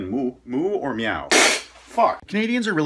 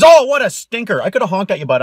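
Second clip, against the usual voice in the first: mock scolding; 8 dB louder than usual. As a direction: tut at the bad driver loudly. Scolding driver.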